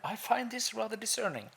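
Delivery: high, mock-refined remark; mock posh. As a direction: say it like a prim aristocrat. Posh joke line.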